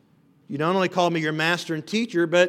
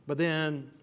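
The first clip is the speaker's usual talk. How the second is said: softer, searching rebuke; quiet challenge; 4 semitones lower, 6 dB quieter than usual. Acted. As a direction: drop the voice and let the question sting. Jesus quietly confronting them.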